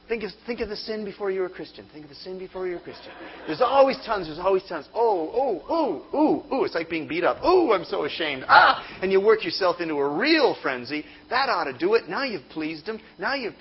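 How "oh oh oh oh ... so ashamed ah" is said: hammed-up anguish. Mock self-punishing shame.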